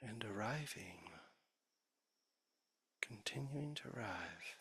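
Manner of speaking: soft, unhurried meditation voice with long pauses; guided meditation. Real, not acted. Teacher leading the sangha's formal practice, soft guided meditation. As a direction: ease listeners gently into stillness.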